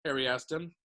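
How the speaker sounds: ordinary delivery, nothing marked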